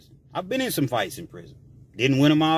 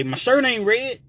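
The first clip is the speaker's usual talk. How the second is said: cocky taunt; 7 semitones higher than usual. Retold or imitated. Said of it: "typically people say".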